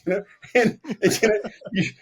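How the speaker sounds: real laugh breaking into the words. Real, not acted. Laughs at his own retold insult.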